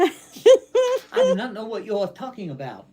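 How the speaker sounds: mock innocence through laughter; laughing denial. Real, not acted. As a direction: deny it while cracking up. Denies the Muppet, laughing.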